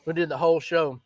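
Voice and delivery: usual speaking voice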